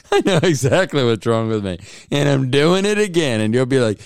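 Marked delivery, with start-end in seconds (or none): none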